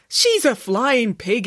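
high, drawn-out exclamation; exclaiming. A narrator's reading. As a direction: exclaim it slowly, in mild exasperation. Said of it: "exclaimed Boris".

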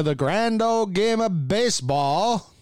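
slow, lilting 1950s broadcaster; old-timey radio announcer. Retold or imitated. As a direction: croon it like an old newsreel. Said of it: "used to do the old-timey radio announcer".

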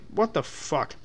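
ordinary talk

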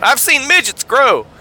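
lightly voiced televangelist; a hint of a TV preacher boast; Robert Tilton. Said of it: "In the words of Robert Tilton".

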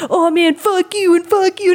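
high mocking bratty kid voice; hammed-up edgy teen. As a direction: mimic a twelve-year-old trying to sound tough. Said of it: "trying to be super cool and edgy".

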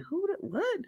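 high, quiet startled question. Retold or imitated. To his home girl, a high startled question. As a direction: act thrown by the surprise. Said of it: "I'm like".

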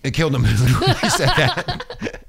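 laughing through the words; chuckling while talking. Real, not acted. Laughing at own joke.

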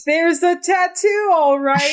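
very high-pitched put-on voice; squeaky little character reporting; Six character voice. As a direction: pitch it way up, at normal volume. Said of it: "Six says".

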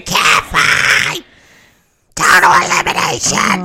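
high, very loud commentator scream; Joey Styles screaming. Acted. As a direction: scream it like an excited wrestling announcer. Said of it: "Joey Styles is in the background screaming".

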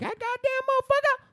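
high-pitched mock outburst; mock angry wife. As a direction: imitate a furious wife shrieking. Acts out angry wife charging out.

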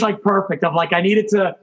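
ordinary talk, nothing marked

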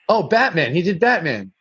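ordinary talk, nothing marked

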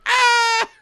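high, loud mock scream; hammed-up falling yell; cartoonish shriek. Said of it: "And Derek's like".

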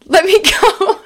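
hammed-up captive begging; laughing plea. Kidnapped Drew begging captors, laughing mock plea.

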